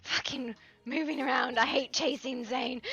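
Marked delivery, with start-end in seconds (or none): none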